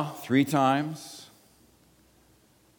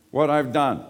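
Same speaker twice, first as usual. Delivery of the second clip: usual speaking voice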